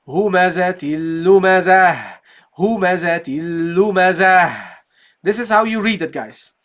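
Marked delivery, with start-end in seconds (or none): 0.1-4.7 recited humazatil lumazah, stopping with a ha; Quran recitation. Shown as a demonstration. Teacher reciting the verse for students. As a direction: read it as a recitation, ending on the ha. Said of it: "So you say".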